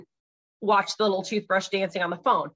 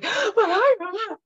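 put-on high, louder gasping child's "But I"; imitating daughter's protest; 5 dB louder than usual. Mom mimics her protesting daughter, high and gasping. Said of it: "if she's like".

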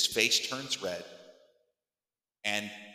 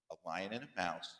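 low, soft, patient retelling by dad; walk a child through it quietly, phrase by phrase; slow walk-through; 6 semitones lower, 12 dB quieter, faster than usual. Retold or imitated. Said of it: "Go real slow".

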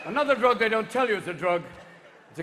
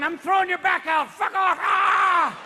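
high, furious mock shouting; protesting heart; 11 semitones higher, 6 dB louder, slower than usual. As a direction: threaten loudly, then cry out. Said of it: "your heart's going".

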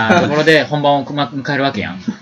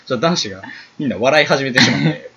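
usual speaking voice

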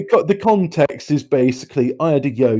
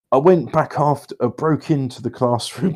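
ordinary talk

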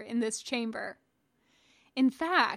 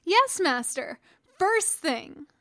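higher, louder eager reply; eager boy promising; enthusiastic; 6 semitones higher, 4 dB louder, slower than usual. A narrator's reading. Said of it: "He nodded enthusiastically".

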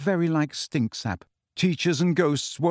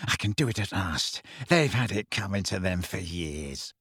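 feverish self-talk; 6 semitones lower, 5 dB quieter than usual. A narrator's reading. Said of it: "talking to himself feverishly".

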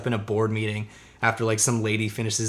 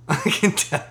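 drawn-out, laughing repeat of a retort; 5 semitones higher than usual. Retold or imitated. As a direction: say it higher through a laugh. Laughs repeating his own retold reply.

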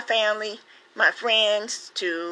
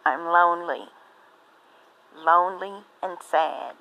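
poem reading. Acted. Reading her poem Lonely to listeners.

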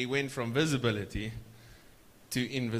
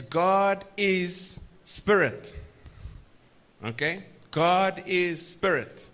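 slow and loud, higher pitched; slow preaching emphasis; 4 semitones higher, 3 dB louder, much slower than usual. Real, not acted. Preacher declaring God's nature to the church: slow loud preaching. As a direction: proclaim it slowly. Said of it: "preaching the attribute".

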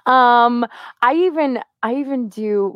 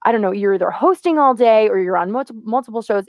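ordinary talk, nothing marked

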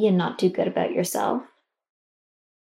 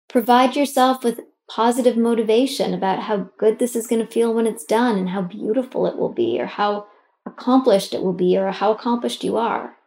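ordinary talk, nothing marked

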